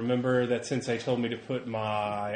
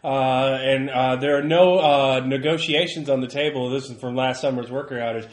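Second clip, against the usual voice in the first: raised, higher voice pressing for answers; insistent reporter; 4 semitones higher, 6 dB louder than usual. Acted. Reporter questioning a reluctant worker, insistent and raised.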